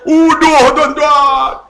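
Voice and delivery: slow loud high call; preaching shout to the congregation; drawn-out shout. Real, not acted. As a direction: stretch the word out loud. Preacher cries out punchline.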